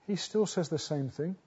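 usual speaking voice

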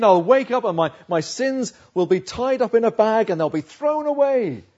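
loud, high-pitched proclamation; fervent hope. Acted. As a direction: preach it out with rising conviction. Preacher voices Job's hopeful dream.

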